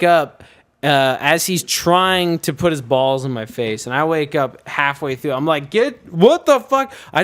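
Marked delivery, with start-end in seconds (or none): 5.7-6.9 high, slow, startled protest; shocked outburst. Retold or imitated. Pledge waking to a prank, retold in high shock. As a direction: wake up appalled, voice jumping high.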